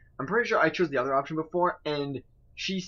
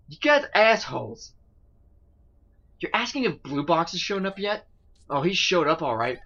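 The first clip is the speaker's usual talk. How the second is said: ordinary talk, nothing marked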